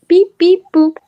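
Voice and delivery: chirpy beep boop; beeping computer noise. Pretend. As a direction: sing out the computer beeps. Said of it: "Pull it up".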